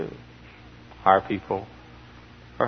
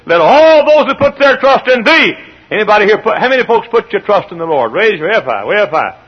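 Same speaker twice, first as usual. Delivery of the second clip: loud, higher preaching; rally the crowd; 10 semitones higher, 9 dB louder than usual. Acted. Preacher to congregation, loud preaching.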